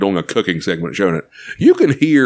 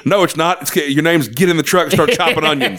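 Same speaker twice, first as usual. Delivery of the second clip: mildly bossy chef, pitch raised; Gordon Ramsay; 7 semitones higher than usual. Acted. Chef sends his monster helper to work, curt bossiness. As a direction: order the helper around impatiently. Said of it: "He goes".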